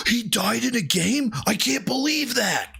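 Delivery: high, sarcastic disbelief; mock gasp of shock. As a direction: fake astonishment to mock him. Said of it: "For someone to get so incensed".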